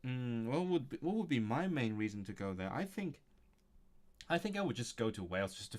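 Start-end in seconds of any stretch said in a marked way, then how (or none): none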